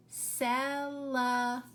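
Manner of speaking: "celebration" broken slowly into four separate syllables. Shown as a demonstration. Teacher sounding out a new word for pupils, syllable by syllable. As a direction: sound out "celebration" with a gap after each part. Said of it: "How many syllables?".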